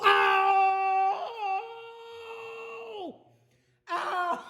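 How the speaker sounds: sharp high cries of real pain; pained cry. Real, not acted. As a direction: yelp as it's yanked. Toenail pulled back down.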